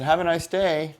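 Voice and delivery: sarcastic have a nice day, high and loud; "Have a nice day" with the first word punched, high and sharp; dismissive goodbye to a rude driver; pointed farewell. Retold or imitated. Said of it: "I said".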